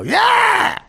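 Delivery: very high, loud yeah; mock yelp. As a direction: squeal it out triumphantly. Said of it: "I go".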